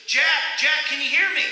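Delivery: loud and high, calling down from far above; booming call from above. Acted. God calling down to Jack on the cliff, loud call. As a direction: shout down the cliff to find him. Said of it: "a voice from above".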